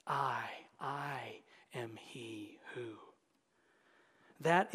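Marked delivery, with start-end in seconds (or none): 0.1-3.0 slow, reverent whispered quote. Acted. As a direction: linger on each word in a whisper. Repeating Jesus' words hushed.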